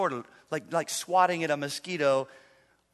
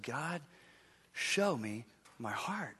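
much softer, slow lowered prayer; 5 semitones lower, 12 dB quieter, much slower than usual. Retold or imitated. Pastor alone praying for insight, soft and slow. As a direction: plead gently with God.

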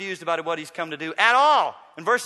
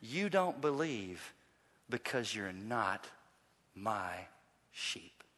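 slow, low and hushed, with pauses; solemn verdict; 9 semitones lower, 11 dB quieter, slower than usual. Acted. Jesus to unbelievers, solemn and hushed. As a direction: let each phrase drop, quiet and grave.

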